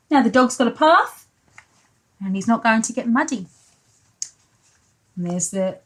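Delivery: ordinary delivery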